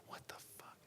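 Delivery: barely audible whispered curse; whispered shock; stunned whisper. Acted. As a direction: mouth it in disbelief, almost no voice. His stunned reaction to her accusation.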